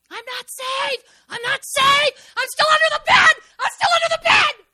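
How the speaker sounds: high, loud anguished shout, building; yelled out. Retold or imitated. Retelling her breakthrough cry at Winston's house, shouting. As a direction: cry out from the child under the bed. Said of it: "I yelled out".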